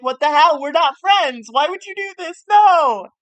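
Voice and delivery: betrayed wrestlers yelling at their partners; furious outburst. Retold or imitated. Wrestlers protesting a forced match, hammed-up furious shout. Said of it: "they're like".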